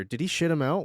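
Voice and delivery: ordinary delivery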